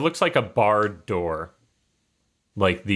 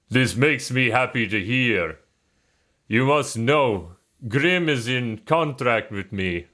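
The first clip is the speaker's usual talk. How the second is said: put-on Russian accent; 3 semitones higher than usual. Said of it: "I don't know why I went Russian".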